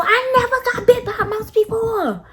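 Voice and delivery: indignant protest. Retold or imitated. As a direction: protest it hard, stressing never.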